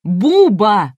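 громкий высокий окрик; выкрикнуть имя, сердито поправляя; зло. A narrator's reading. Разозлённая Энн поправляет ведущего. (сердитый громкий выкрик)

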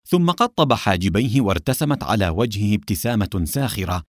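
usual speaking voice

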